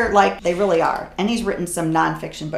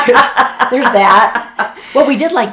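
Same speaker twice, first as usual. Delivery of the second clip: laughing loudly through the words; 7 semitones higher, 11 dB louder, slower than usual. Real, not acted. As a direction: crack up while talking, high and loud. Laughing at psycho joke.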